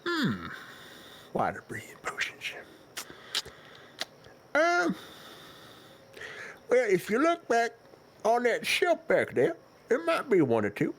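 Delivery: put-on high, wavering old turtle shopkeeper; very old man. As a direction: ramble like an ancient shopkeeper. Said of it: "God, he's ancient".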